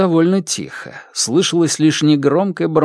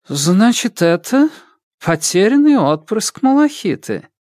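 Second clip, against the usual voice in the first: queen's voice; 8 semitones higher than usual. Acted. Queen Onyx speaks to Moon.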